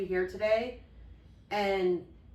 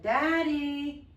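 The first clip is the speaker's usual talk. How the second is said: high, louder drawn-out call to dad; retold louder call; 8 semitones higher, 5 dB louder than usual. Child calling dad who didn't answer, insistent retold calling. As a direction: call him higher, wanting an answer. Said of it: "And he didn't say anything. So I said".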